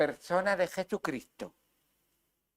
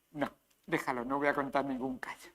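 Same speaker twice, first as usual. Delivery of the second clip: hushed, backing off the story; whispered aside; 6 semitones lower, 9 dB quieter, faster than usual. Real, not acted. Preacher deciding not to tell a story, whispering. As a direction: whisper it to yourself.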